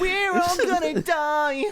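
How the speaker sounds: high, slow, hammed-up doomsday wail; mock panic. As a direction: wail it like the world is ending.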